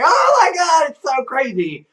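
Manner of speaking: high-pitched mock panic on oh my God; mock hysterical. Everybody in business screaming at each other, mock panic. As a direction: squeal it like an overexcited insider. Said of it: "everybody's screaming at everybody else, going".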